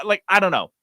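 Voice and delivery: ordinary talk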